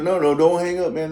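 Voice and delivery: high, loud acted pleading; imitating an insistent caller; urgent plea. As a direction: stop them hanging up, urgently. Caller pleads not to hang up.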